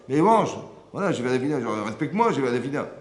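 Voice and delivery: loud, high mock insistence from the wife; insistent wife. Wife insisting her husband eat, loud and high.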